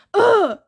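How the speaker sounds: high, loud exasperated ugh; frustrated groan. A narrator's reading. Thalia groaning at Percy before shoving him, frustrated. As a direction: explode in frustration.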